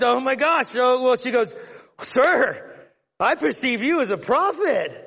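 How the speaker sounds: mock flustered surprise; startled woman. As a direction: caught off guard, stammering praise.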